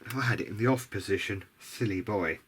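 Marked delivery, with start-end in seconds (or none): none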